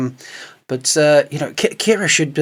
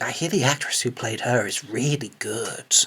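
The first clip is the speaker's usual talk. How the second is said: soft, lower whispered hint; sly whisper; 4 semitones lower, 9 dB quieter than usual. Pretend. Imagining a sneaky compliment near her outfit, whispered.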